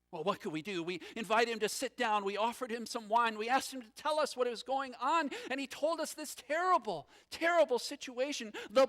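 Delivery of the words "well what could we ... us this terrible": ordinary delivery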